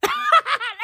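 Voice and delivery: high incredulous laugh; real laugh at the absurdity. Real, not acted.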